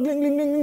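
high sung jingle of crystals; high-pitched clinking imitation; imitated chandelier tinkling. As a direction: tinkle like rattling crystal mid-aria. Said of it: "On entendait les cristaux cliqueter".

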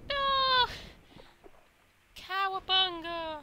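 very high, drawn-out falsetto cry; cartoon squeal. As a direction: squeak it while being hurled. Voices the character being flung.

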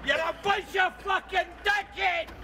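angry yelling at the men taking the truck; furious shout. Real, not acted. Truck owner screams abuse at the repo men, furious shout. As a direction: bellow it after them across the yard.

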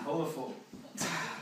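ordinary talk

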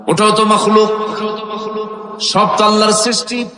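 melodic sermon chant on long held notes; সুরেলা ওয়াজ. A narrator's reading. Preacher chants the story melodically. (সুর করে টেনে টেনে ওয়াজের বয়ান)

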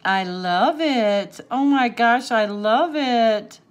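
loud, slow, drawn-out delight; excited, lingering adoration; emphatic gushing. Real, not acted. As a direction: lean hard on each word, thrilled. Gushing over the gift.

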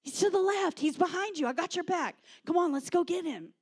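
high, loud rallying shouts; urgent, hammed-up war-game commands; declaring battle calls. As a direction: call out enemy positions to your comrade. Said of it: "declare to one another".

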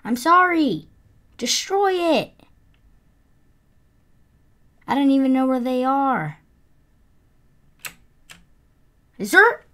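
high, loud panicky rush; frantic. Real, not acted. Gamer panicking mid-fight, loud and high. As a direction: blurt it out in a panic.

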